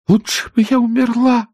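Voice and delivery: high, wailing self-pity; sobbing wail. Acted. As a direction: wail it into the pillow. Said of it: "самозабвенно всхлипывала она". (самозабвенно рыдая)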